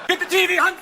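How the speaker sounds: loud, high mock holler; hammed-up urgent shout; calling out. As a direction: yell it to your wife. Norwegian drive-by shooter calling out.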